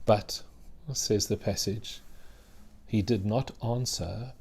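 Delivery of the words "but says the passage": usual speaking voice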